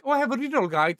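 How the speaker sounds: high, bright mock voice; imitating a viewer. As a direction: play an eager viewer. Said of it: "they're gonna be like".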